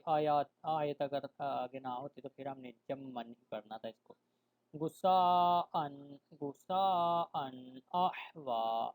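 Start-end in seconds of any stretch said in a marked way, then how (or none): 4.7-8.9 غصاءً drawn out with long vowels; slow recitation. Shown as a demonstration. Teacher reciting for students, slow chant. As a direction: chant غصاءً احوا slowly. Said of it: "teacher recites example".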